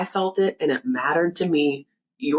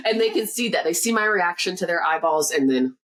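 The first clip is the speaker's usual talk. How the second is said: normal talking voice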